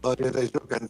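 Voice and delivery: ordinary delivery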